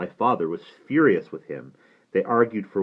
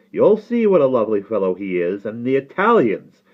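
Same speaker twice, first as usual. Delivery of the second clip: heated, scornful scolding; indignant father; 11 semitones higher, 9 dB louder than usual. Acted. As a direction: cut in and challenge your nephew to try it.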